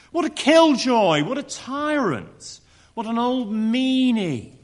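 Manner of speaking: mock God as a killjoy, drawing it out; spiteful mocking jeer. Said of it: "He doesn't portray God as a protective father, but a spiteful one".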